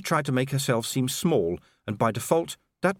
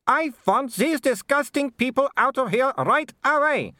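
high, loud, slow imperious Baroness; indignant command; 5 dB louder than usual. A narrator's reading. Baroness orders intruders from her hall, high and indignant. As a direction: order them out, pitched far up.

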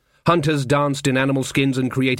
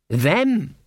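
dawning realization; 7 semitones higher than usual. Acted. Jason finally understands.

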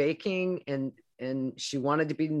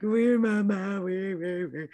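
put-on a touch of higher toddler babble; 4 semitones higher than usual. Mother voicing her toddler answering after the scolding, lightly higher babble. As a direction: babble it like a small child. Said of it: "she's like".

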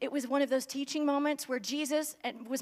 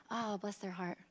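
pitying sigh; 5 semitones lower, 12 dB quieter than usual. Retold or imitated. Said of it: "Jesus' response to crowds ... was".

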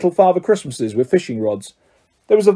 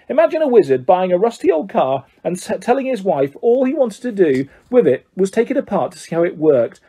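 plain reading voice

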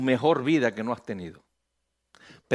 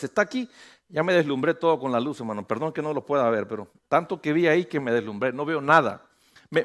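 usual speaking voice

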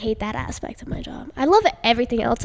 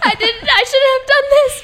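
laughing; 13 semitones higher, 3 dB louder, slower than usual. Real, not acted. Laughing at own speech.

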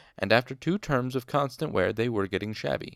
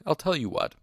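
lordly offer, pitched up; magnanimous; 7 semitones higher than usual. A narrator's reading. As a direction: make a show of generosity, starting high. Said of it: "he said magnanimously".

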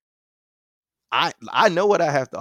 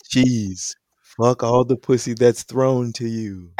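God voice; slower than usual. Pretend.

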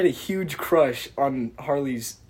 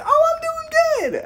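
very high, loud falsetto; mock girly reply; 5 dB louder than usual. Girl answering the drunk guy's greeting, mock girly falsetto. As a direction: squeak it like an over-sweet girl. Voicing the girl's gushing reply.

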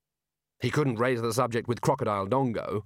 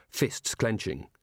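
plain reading voice

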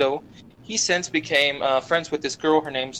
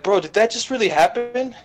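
higher, incredulous question; mock amazement; 7 semitones higher than usual. Storyteller mimics Ando after the fight, mock amazement. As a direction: imitate an astonished friend, pitch climbing.

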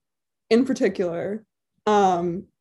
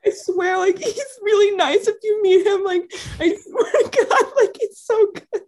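laughing pleading defense; 8 semitones higher than usual. Retold or imitated. Replays her own defense, laughing.

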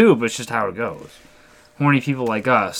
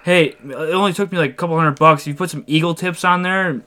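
higher-pitched, eager truck enthusiast; put-on excited guy voice; moving-truck guy; 6 semitones higher than usual. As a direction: imitate a stranger selling his truck upgrades. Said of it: "And he goes".

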